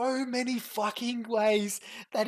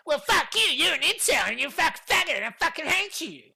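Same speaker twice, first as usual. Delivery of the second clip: mock angry rant; 4 semitones higher, 6 dB louder, faster than usual. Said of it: "you started going".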